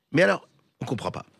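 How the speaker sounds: ordinary talk, nothing marked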